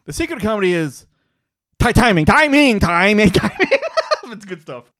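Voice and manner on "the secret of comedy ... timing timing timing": loud, mistimed repeated blurting; imitating Steve Martin's delivery. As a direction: blurt it over and over, ending in laughter. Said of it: "he goes like this".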